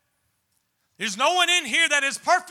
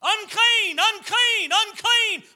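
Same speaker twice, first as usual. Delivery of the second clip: calling out unclean; 6 semitones higher, slower than usual. Retold or imitated. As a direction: announce yourself to the whole store. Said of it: "You go into the Dollar General store".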